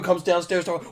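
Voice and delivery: ordinary talk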